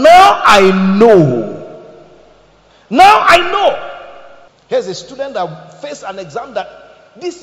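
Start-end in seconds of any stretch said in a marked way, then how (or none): 0.0-3.9 very slow, loud, drawn-out proclamation; shouted declaration. Acted. Preacher crying out God's words to Abraham, very slow loud shout. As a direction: hold every word and shout it to the back row.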